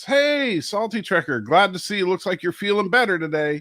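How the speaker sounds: high, bright hello from a well-wisher; over-friendly greeting, starting high; mock cheery greeting. Mimicking a well-meant greeting he hates.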